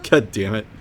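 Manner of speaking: normal talking voice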